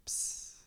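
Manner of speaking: short soft unvoiced hiss; little puff of sound to still a storm; hushing "psst". Jesus calming the storm, quick soft hiss.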